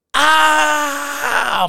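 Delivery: loud, high, long howl; scream of pain. Acted. Lion after hitting himself with the stone, screaming. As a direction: let out a long acted scream. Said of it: "μπει μια φωνή".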